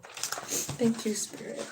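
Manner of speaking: voiceless, fading whisper; hushed gratitude fading into silence; whispered thanks. Real, not acted. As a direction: breathe a small prayer of thanks. Quiet thanks to spirit after reading.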